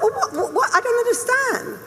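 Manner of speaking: high, baffled stammer of disbelief; bewildered. Retold or imitated. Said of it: "and I thought".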